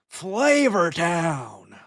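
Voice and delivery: slow drawn-out Guy Fieri shout; Guy Fieri catchphrase. Retold or imitated. Riffing on a Guy Fieri lookalike, slow mock announcer. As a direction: ham up the Flavor Town call.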